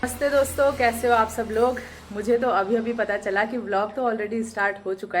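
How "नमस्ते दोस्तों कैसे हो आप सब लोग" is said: vlog greeting. Acted.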